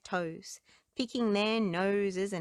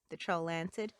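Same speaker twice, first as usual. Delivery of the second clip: ordinary delivery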